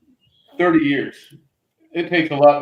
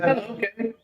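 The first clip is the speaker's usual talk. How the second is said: put-on little kid; 7 semitones higher, 5 dB quieter than usual. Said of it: "And I'm little".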